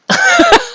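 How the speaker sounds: sudden squeaky burst of laughter; incredulous high laugh. Real, not acted. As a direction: laugh in shocked disbelief. Laughing at recalled insult.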